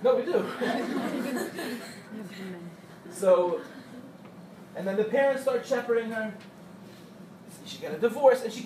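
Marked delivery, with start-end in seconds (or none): 0.5-3.1 amused laugh at her own joke; real laugh. Real, not acted. Teacher laughing at her mother-in-law joke with the girls. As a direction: let a laugh slip out after the joke.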